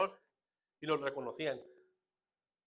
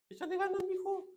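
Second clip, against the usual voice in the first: very high-pitched, singsong cheerleading voice; squeaky pep talk to a son; mock encouragement. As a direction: imitate a glib well-wisher far up in pitch. Acts out easy encouragement to sufferers.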